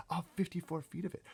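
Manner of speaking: ordinary delivery, nothing marked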